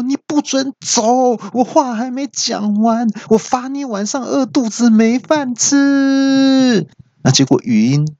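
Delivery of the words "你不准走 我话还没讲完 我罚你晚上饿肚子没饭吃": high, slow mock-furious yell; pitched-up mock rage at the gadget; angry yell. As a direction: forbid it to leave, hammed-up. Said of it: "我就气大喊说".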